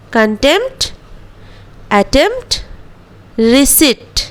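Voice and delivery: contempt, attempt, receipt with p unsounded; silent p. Shown as a demonstration. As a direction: say receipt as risiit, no p. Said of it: "उसी हिसाब से प्रोनाउंसिएट करना है". (पी silent वाले शब्द)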